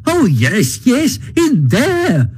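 high-pitched, testy Toad; impatient. A narrator's reading. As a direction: brush it off impatiently, stretching the words. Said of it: "said Toad impatiently".

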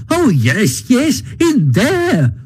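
high, slow, exasperated yes-yes; impatient. A narrator's reading. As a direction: drag out the second yes with impatience. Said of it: "said Toad impatiently".

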